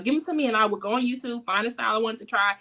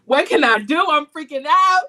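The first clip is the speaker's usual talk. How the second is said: loud, high, hammed-up panicked plea; a frantic text read out as a mock cry for help; 7 semitones higher, 10 dB louder than usual. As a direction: play up the freaking out, loud and high. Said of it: "I text Kiara one day and was like".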